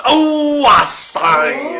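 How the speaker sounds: high then loud, hammed-up oh, I say; mock surprised exclaim. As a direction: gasp it like a startled gent.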